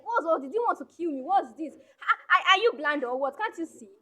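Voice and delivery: high, loud furious yelling at the careless driver; angry shout. Acted. Angry driver shouts at the man who hit her car. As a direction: snap at him like he nearly killed her.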